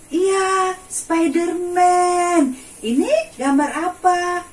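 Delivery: put-on bright, high singsong for little kids; reading to a toddler. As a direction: coax small children with eager questions. Quizzing children with pictures.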